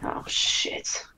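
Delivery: hushed, breathless whisper; whispered curse. Real, not acted. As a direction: mutter it under your breath in dismay. Player reacts to bad news.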